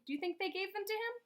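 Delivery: ordinary delivery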